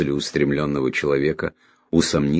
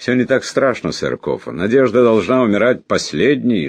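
higher, lively, buoyant voice; cheerful declaration; 7 semitones higher, 6 dB louder than usual. A narrator's reading. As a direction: announce it with breezy good cheer. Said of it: "жизнерадостно заявил Джуфин".

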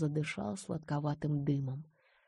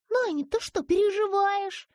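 put-on child voice; 7 dB louder than usual. A narrator's reading. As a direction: ask gently like a small girl. Girl on the phone. (детский голос)

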